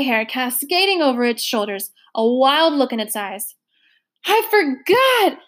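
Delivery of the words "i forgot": high, slow moan; long anguished wail, slow. A narrator's reading. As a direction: moan it out in despair. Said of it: "it was moaning as it swayed".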